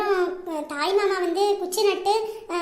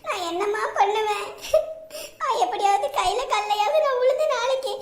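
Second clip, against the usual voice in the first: sobbing, broken pleading; distraught daughter weeping to her mother; crying. Acted. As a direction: beg helplessly through tears. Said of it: "எம்மாடி, அழாத".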